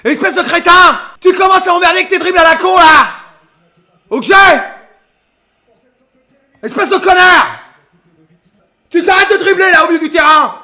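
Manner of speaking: loud, hot yelling at a player; furious shout. Real, not acted. Fan screaming at Balerdi on the stream, furious shout. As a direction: bawl him out from the stands.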